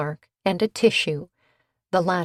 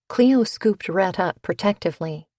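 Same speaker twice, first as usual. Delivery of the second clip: usual speaking voice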